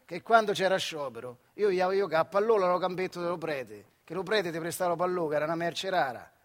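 ordinary talk, nothing marked

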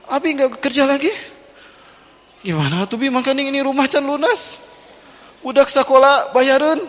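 high, anxious wife voice; a lightly voiced panicking wife; worried wife. Wife frets about the unpaid house, high and anxious.

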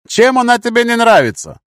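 very high, loud question; shouted retort. A narrator's reading. Fighter answering comrade's complaint under fire, high shout. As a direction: call back over gunfire.

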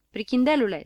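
loud teasing call. A narrator's reading. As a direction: shout it at him from the door. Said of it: "strigă ea". (strigăt poznaș)

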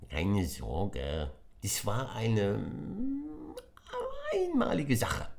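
high impish creature voice; mischievous creature confessing; little creature. Acted. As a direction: explain cheekily. Brasso's voice.

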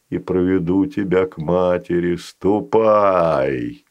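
very low, slow, menacing; a creature taking possession; deep sinister creature. Acted. Fazanol voice.

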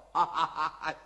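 a big scornful ha-ha-ha, dropping low; hearty laugh. Acted. Wu Song laughing at the jailer's threat, hearty mocking laughter. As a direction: laugh in his enemy's face, deep and mocking. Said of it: "武松闻听哈哈笑".

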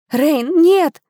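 high, loud alarmed gasp; gasping cry. A narrator's reading. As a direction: gasp out a desperate warning. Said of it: "ахнула Ханна".

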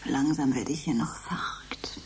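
leise, verängstigt geflüstert; flüstert sich allein im Dunkeln Mut zu; ängstliches Flüstern. Acted. (verängstigt vor sich hin geflüstert)

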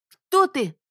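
high, loud scared shout; frightened cry. Acted. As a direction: cry out in fear. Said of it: "снова воскликнула Бонни".

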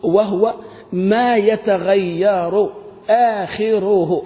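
"ما يتغير آخره" very slowly, with a laugh; slow quoted definition. Shown as a demonstration. Teacher quoting the sheikh's definition slowly. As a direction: draw the quote out. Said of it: "لما جاء الشيخ قال".

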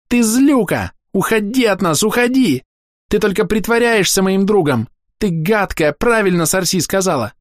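put-on very high, loud furious little girl; screaming child. A narrator's reading. Girl screams insults at Akimi, very high and loud. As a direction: scream every insult at her.

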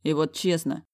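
ordinary delivery

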